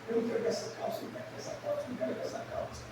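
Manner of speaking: whimpering, hushed mock crying; imitating crying daughter. Father imitating his daughter crying over trousers, hushed whimper. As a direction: whine it like a crying little girl. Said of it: "Ela começou a chorar".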